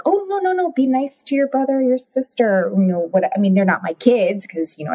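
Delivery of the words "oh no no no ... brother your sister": high, gently scolding parent voice; mock kindly parent voice; sing-song parent. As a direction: play the sweet-voiced grown-up telling kids to be nice. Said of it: "I should be like".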